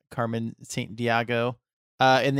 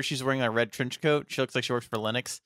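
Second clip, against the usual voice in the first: ordinary talk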